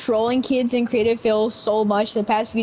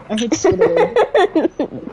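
"Squidward" stretched high and slow; mocking drawn-out repeat; 7 semitones higher, much slower than usual. Shown as a demonstration. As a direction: drag out "Squidward" teasingly, pitch up. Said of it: "You said Squidward".

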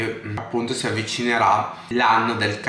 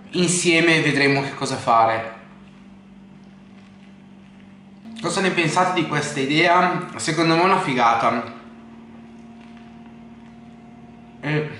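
talking between bites of sandwich; eating while speaking; mouth full, chewing; 4 semitones higher than usual. Real, not acted. Seen 3: sandwich held at mouth, bites and chews between words.

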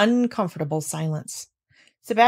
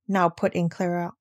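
plain reading voice

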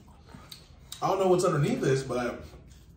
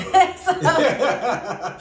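laughing; 9 semitones higher, 6 dB louder than usual. Real, not acted. Breaks into laughter.

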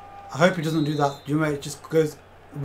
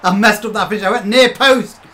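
loud, higher rant at yourself; frustrated outburst; 5 semitones higher, 11 dB louder than usual. Real, not acted. Streamer berates his own finish, loud and higher. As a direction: blow up at your own mistake.

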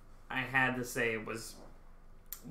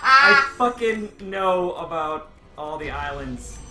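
exasperated insistence; 3 semitones higher, 10 dB louder, much slower than usual. Real, not acted. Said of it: "I know that".

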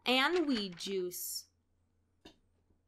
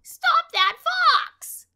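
much higher, loud slow cry; outcry; 13 dB louder, much slower than usual. A narrator's reading. Birds chase the fleeing fox, loud and high. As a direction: shout after him. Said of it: "cried the birds".

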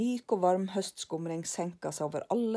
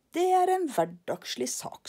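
much higher, airy brush-off; dismissive Karlsson; 10 semitones higher, 4 dB louder than usual. Acted. Karlsson brushes off lillebror, much higher. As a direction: wave it away grandly.

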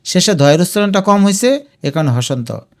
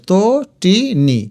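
Tatini slowly as to, ti, ni; syllable by syllable; 3 semitones higher, much slower than usual. Shown as a demonstration. Teacher sounds it out for students, Tatini in slow syllables. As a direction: gap after each syllable of Tatini. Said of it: "এই যে".

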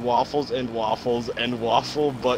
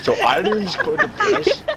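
laughing loudly; high, loud laughing while reading; 5 dB louder than usual. Real, not acted. Reading absurd text, cracking up.

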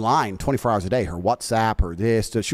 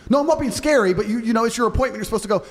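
higher, whiny girlfriend voice, lightly played; mock girlfriend protest; 7 semitones higher, faster than usual. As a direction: play the hurt girlfriend denying it. Acted girl arguing back.

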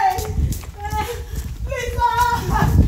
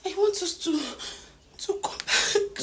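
breathy plea; 4 semitones lower, 11 dB quieter, much slower than usual. Acted. Tearful plea to be let in.

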